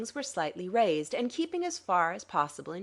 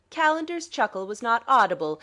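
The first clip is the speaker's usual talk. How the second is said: ordinary delivery, nothing marked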